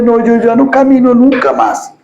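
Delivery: a lightly voiced child refusing to walk again; whining child. Preacher imitates a fallen child giving up, mild mock whine. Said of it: "se queda llorando y dice".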